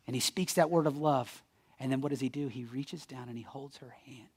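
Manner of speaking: very soft, low, reverent delivery; hushed storytelling. Acted. Jesus waking a child at her bedside, hushed storytelling. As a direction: drop almost to a whisper, tenderly.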